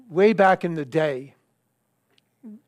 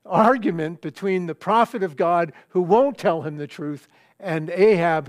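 ordinary talk

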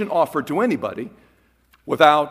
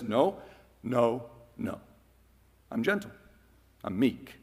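softer, meek and gentle refusal; gentle Jesus voice; 7 semitones lower, 7 dB quieter than usual. Acted. Preacher voicing Jesus to the crowd, soft and meek.

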